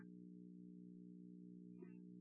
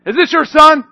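loud interrogating; 11 semitones higher, 10 dB louder than usual. Retold or imitated. Leaders questioning parents.

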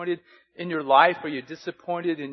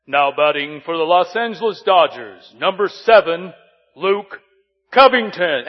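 stadium PA announcer; 11 dB louder than usual. Retold or imitated. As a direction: announce the batter to a full stadium. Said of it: "P A announcer had this big, deep voice".

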